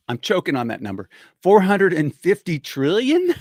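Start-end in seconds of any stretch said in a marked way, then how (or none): 1.4-3.3 louder, slow, incredulous question; disbelief. Real, not acted. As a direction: stretch it out in disbelief. Disbelief at the number.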